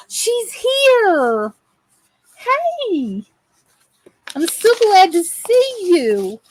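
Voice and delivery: high, delighted hello to a friend; light up as she walks in; excited greeting. Real, not acted. Greeting a friend who just arrived, high and delighted.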